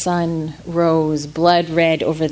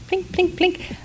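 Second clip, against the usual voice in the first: soft ukulele plinks; 10 semitones higher, 7 dB quieter than usual. A narrator's reading. Boy plays ukulele.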